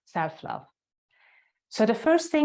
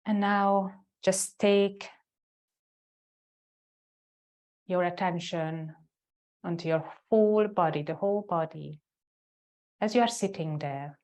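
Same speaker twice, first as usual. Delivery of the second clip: guided meditation. Real, not acted. Yoga teacher guiding students into the heart center, guided meditation. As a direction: lead them inward, leaving space after each phrase.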